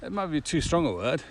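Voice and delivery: ordinary talk, nothing marked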